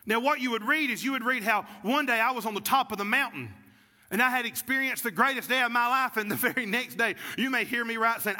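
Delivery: ordinary delivery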